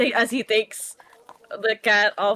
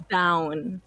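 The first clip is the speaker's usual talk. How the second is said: lightly voiced low command, one word; spell command; 5 semitones lower than usual. Caster commands the cat in the tree, low command. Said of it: "just cast command, just like".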